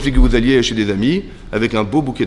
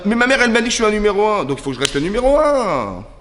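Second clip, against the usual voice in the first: put-on whining spoiled child; 8 semitones higher than usual. Caïn clinging to first place, whiny. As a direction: wail it like a mother's wounded favourite. Acting Caïn's childish wounded pride.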